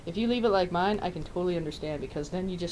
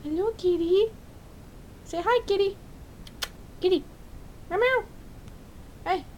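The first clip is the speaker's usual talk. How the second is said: much higher cooing pet voice; talking to a cat; 12 semitones higher than usual. Real, not acted. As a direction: coax the cat sweetly, high up. Baby-talks to cat.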